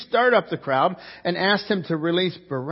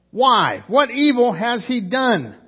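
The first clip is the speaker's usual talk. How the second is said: loud, higher, slowed protest; raised plea; 6 semitones higher, 6 dB louder, slower than usual. Acted. Pilate protesting to the shouting crowd, loud plea. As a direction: push back at the crowd, pleading.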